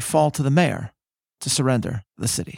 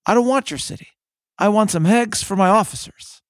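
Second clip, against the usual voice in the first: lightly voiced gruff soldier, a bit higher; blunt private; 6 semitones higher, 4 dB louder than usual. Private brushing off the mayor, lightly voiced blunt retort. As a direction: toss it off like an impatient cook. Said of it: "Private Murch reportedly replied".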